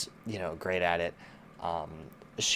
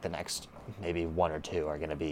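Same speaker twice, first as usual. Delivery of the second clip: ordinary delivery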